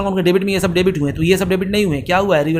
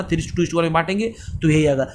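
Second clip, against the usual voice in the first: normal talking voice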